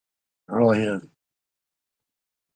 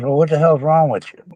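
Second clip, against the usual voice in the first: imitating annoyed girlfriend; 6 semitones higher, 4 dB louder than usual. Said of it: "She goes".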